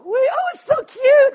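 very high, loud squeal; mock cutesy gushing. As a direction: gush sarcastically in a squeaky high voice. Mocking cute Noah's Ark toys.

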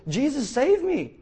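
high, loud, drawn-out cry for help; hammed-up panicked plea; sinking Peter. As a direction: wail it like you're going under. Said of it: "You know, Peter goes".

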